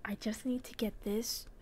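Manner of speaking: fast sneaky whisper. Real, not acted. Sneaking in game.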